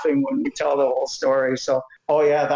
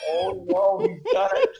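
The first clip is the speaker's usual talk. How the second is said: laughing; slower than usual. Retold or imitated. Umpire realising the prank landed.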